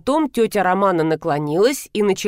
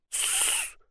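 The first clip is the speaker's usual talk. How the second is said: soft, voiceless shush; quiet "tsss"; 16 dB quieter than usual. A narrator's reading. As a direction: hush someone sharply under the breath. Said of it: "шикнула Звягина".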